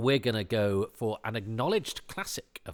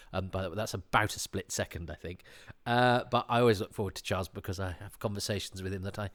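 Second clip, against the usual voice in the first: normal talking voice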